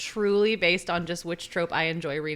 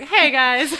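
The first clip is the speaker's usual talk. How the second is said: infomercial host; 6 semitones higher, 9 dB louder than usual. Retold or imitated. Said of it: "like, an infomercial".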